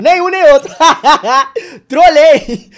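laughing through the words, high and loud. Real, not acted. Laughing at chat after trolling the vote. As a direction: crack up while owning the troll.

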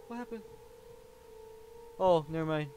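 ordinary talk

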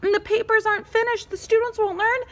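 high, urgent panic building to a loud cry; panicked character. Acted. Pencil frets over ungraded papers, panicked.

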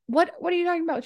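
high-pitched baffled retort; mock bewilderment. Kidnapped girl answering the operator, mock bewilderment. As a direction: act out a baffled caller, voice shooting up. Confused caller acted out.

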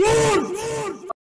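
high, loud cheer of delight; excited shout. Real, not acted. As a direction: yell it out at the win. Cheering while opening crates.

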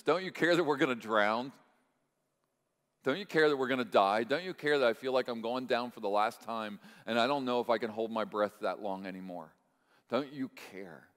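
desperate plea. Acted. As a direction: beg as if about to drown, then let it sink. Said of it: "let me finish their sentence".

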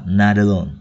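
not alone run together as "nada-lone"; t turned to d. Shown as a demonstration. Teacher explains t becomes d, not alone as "nada-lone". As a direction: link not alone with a d sound. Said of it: "Chữ tờ đổi thành chữ đờ".

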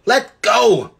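high, loud cry; shouting. Acted. Polly shouts at the witch holding her hair. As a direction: yell to be released. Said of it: "Polly had been shouting out".

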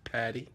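Patty for Paris, softly said, ending on ee; mock French pronunciation. Shown as a demonstration. Coach repeating the local name for viewers, soft French-style Patty. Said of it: "We say Patty".

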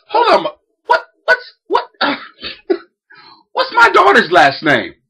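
high, laughing through the question; laughing disbelief. Retold or imitated. Dad laughing in disbelief at the boy. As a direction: crack up mid-outrage.

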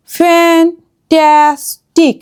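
Fantastic very slowly in three separate pieces; syllable by syllable. Shown as a demonstration. Teacher showing closed syllables, word split slowly. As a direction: break fantastic into fan, tas, tic with pauses.

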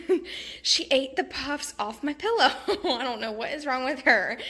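amused exasperation, laughing as you go. Real, not acted. Vlogger telling viewers the puppy ate her pillow puffs, laughing.

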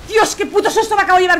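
startled shout after a jump scare; scared shout. Real, not acted. Gamer startled by a monster, shouting in fright. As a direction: shout it out, heart pounding.

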